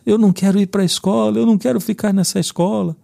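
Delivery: high-pitched mock whine of a tearful kid; imitated child crying. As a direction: imitate a little boy wailing his refusal. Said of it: "ele começou a chorar".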